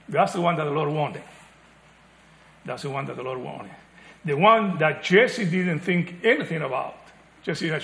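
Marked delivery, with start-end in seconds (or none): 2.6-3.7 soft emphasis. Shown as a demonstration.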